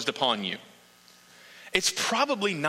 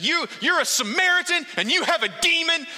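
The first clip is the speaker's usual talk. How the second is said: much higher and louder, an outraged accusation; heated accusation; 14 semitones higher, 4 dB louder than usual. Acted. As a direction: throw the insult at him in a worked-up rage. Pharisees hurling the accusation.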